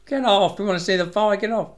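high, loud mock heckling from the audience; jeering crowd. Fight-hungry crowd heckling the manager, loud mock jeer. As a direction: shout him off the stage like an impatient crowd.

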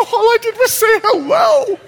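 very high mock-panicked squeal; comic high-pitched protest; protesting innocence. Acting his startled protest of innocence.

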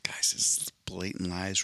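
slow, hushed stage whisper; mock whisper. Co-host calling out Mondo's denial to listeners, mock whisper. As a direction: whisper it slowly as a mock confidential aside.

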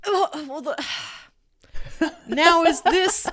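usual speaking voice

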